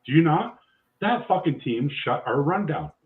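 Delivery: ordinary delivery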